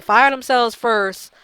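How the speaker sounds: loud, slow, hammered out; angry demand. Real, not acted. Vlogger rants at the game studio, loud and slow. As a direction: slam each word down in anger.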